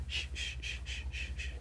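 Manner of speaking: soft unvoiced shh shh of a knife sawing bread; whispered shh. Shown as a demonstration. Teacher imitating a knife cutting bread, soft rhythmic shh. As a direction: breathe the sound of cutting bread.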